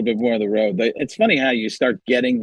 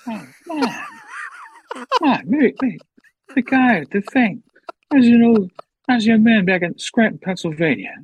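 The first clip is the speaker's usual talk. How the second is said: rambling elder statesman voice, pitched up; Joe Biden; 9 semitones higher than usual. Acted. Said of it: "How do you feel about President Biden now?".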